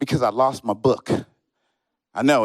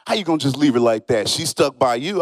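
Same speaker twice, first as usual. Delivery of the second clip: pitched-up disbelieving friends; high incredulous friends; 6 semitones higher than usual. Retold or imitated. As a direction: play the friends, high and outraged. Friends voiced scolding him.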